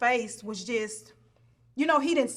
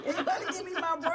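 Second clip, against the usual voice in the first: laughing repeat; 6 semitones higher, 4 dB quieter, faster than usual. Retold or imitated. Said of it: "he was like".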